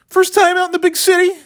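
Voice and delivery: very high jeering call; mock yelling. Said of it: "And I start yelling".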